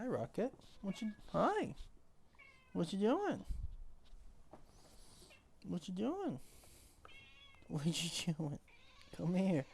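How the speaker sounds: high cooing voice for the cat. Real, not acted. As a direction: coo at the cat. Baby talk to his cat.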